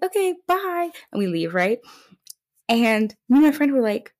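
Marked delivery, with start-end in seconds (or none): 0.0-0.9 high, sing-song polite exit; awkward goodbye. Retold or imitated. Leaving a boring conversation, high awkward goodbye. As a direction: pitch it up to escape politely.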